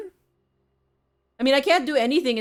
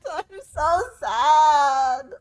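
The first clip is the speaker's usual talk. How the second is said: very slow mock sorrow; melodramatic lament; much slower than usual. Streamer hamming up sadness for viewers, very slow. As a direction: draw out the fake grief. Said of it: "We're very melodramatic today".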